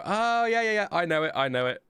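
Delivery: high-pitched delighted realisation, settling down; sudden recognition. Acted. Streamer imagining himself remembering the anthem later, mock sudden recognition. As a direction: act out the penny dropping, starting high.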